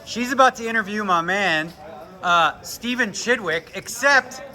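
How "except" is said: excited outburst. Real, not acted. Vlogger sees interviewer mix up his friends, excited outburst. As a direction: blurt it out, can't believe it. Interviewer mistakes Seth for Chidwick.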